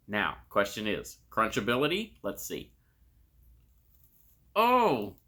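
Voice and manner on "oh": sudden surprised oh; startled exclamation at the taste. Real, not acted. First bite, surprised by texture.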